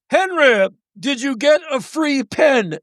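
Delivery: mock a drunk breaking the silence. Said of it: "everyone is, like, very quiet".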